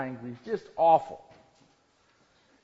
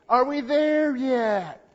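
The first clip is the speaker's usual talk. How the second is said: put-on loud, high, drawn-out child's whine; whining kid; 10 semitones higher, 10 dB louder, slower than usual. Kid asks parents on a long car trip, whining. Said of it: "what do the kids say".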